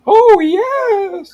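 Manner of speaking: very high, loud wailing wrestling-manager voice; Paul Bear. Acted. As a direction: wail it out in a piercing, drawn-out character voice. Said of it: "Good Paul Bear voice".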